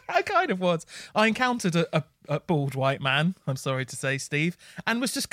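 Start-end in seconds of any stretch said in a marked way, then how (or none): 0.1-0.9 giggling admission; laughing. Real, not acted. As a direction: own it through a laugh.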